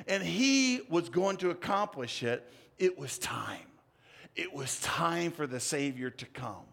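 usual speaking voice

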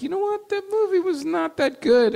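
squeaky high put-on voice; mock high voice. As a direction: pitch way up, gossipy and prissy. Mocking the movie after seeing it.

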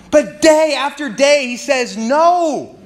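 slow, high, drawn-out emphasis on day after day; emphatic. Real, not acted. Preacher stressing Joseph's daily refusal, slow and emphatic. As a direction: stretch every word to show the endurance.

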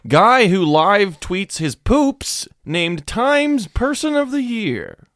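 slow, high, dramatic headline; news headline read. Acted. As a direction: announce it like breaking news.

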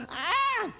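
high exasperated "ah"; throw-up-your-hands wail, acted; exasperated cry. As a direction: cry out as if giving up. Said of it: "throwing your hands up and going".

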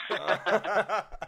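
high delighted laugh; burst of laughter. Real, not acted. Storyteller cracking up after the punchline, loud laugh. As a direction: laugh at your own punchline.

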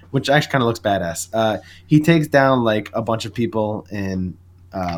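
ordinary delivery

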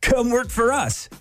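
hyped-up cringey ad pitch; cringey youth ad. Acted. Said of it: "something kinda like this".

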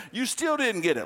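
high, teasing exasperation; exasperated God. Acted. God repeats vision to Peter.

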